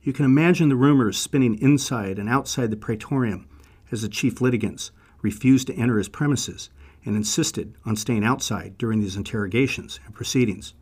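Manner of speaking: ordinary talk, nothing marked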